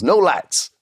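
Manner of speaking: high, lightly voiced Texan mentor, casual; Texas boy. Bruce greeting Freddie and his giant in a casual high Texan drawl. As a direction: toss it off as a breezy Texas good ol' boy. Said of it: "good old Texas boy".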